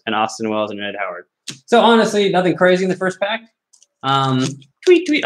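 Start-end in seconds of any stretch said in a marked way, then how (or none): 4.8-5.2 bird call. Pretend. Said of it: "expand my bird calling".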